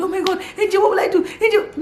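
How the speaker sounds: high, frantic calling for help; retold panicked shout. Mother calling her husband for help, high and frantic. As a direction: cry out for help, half laughing. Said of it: "I just started shouting".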